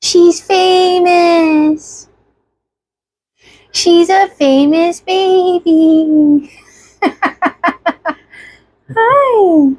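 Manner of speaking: very slow, high and proud. Real, not acted. Cooing at baby.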